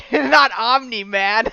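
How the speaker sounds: high, loud, slow through laughter; real laughing. Real, not acted. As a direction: burst out laughing on the words. Breaks up laughing at interruption.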